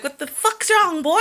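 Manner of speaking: high, loud, laughing mock outburst; laughing mock yell. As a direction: yell at the boy through laughter. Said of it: "I'd be like".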